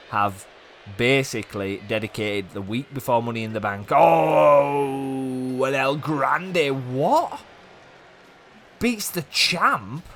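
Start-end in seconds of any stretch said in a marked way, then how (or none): 3.9-10.0 high, slow, stunned cry of surprise; shocked disbelief. Real, not acted. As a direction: gasp it out as if the upset is unbelievable. Shocked by upset win.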